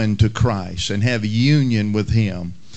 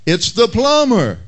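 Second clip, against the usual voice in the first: higher, calling-out answer; plumber yelling; 8 semitones higher than usual. Retold or imitated. Plumber answering the parrot, higher mock yell. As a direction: yell it up the stairs, mildly. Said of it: "the plumber yelled out".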